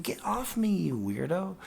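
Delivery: mock squeaky protest. Pushing friend away.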